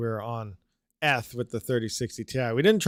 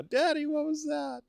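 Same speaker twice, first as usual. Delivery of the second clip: put-on scared child voice; 3 dB quieter than usual. Kid scared by the voice.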